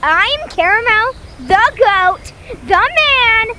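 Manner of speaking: loud, high, slow boast; hype intro. Pretend. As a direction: announce yourself like a champ. Hyping himself on intro.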